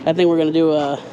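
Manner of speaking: normal talking voice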